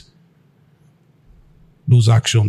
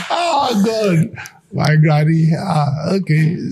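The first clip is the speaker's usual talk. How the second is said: laughing sigh; 5 semitones higher, slower than usual. Real, not acted. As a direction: still chuckling, catch your breath. After laughing at granny.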